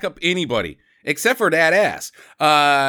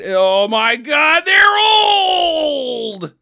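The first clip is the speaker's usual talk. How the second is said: building to a loud, high wail; slow, stretched cry of hammed-up horror; mock horrified cry; 10 semitones higher, 6 dB louder, much slower than usual. Kids realising the couple are old, hammed-up horrified shout.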